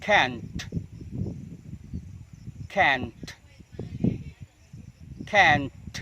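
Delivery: can't, drilled slowly with an audibly exploded T; released final t. Shown as a demonstration. Teacher repeating three times before the examples, T popped in can't. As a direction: let the T of can't burst at the end. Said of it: "Jadi diletupkan".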